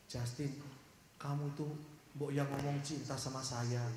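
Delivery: soft, quiet pleading murmur; inner wish. Acted. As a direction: say it softly, as if to herself. Inem's secret wish in heart. (Inem berharap dalam hati, pelan dan lembut)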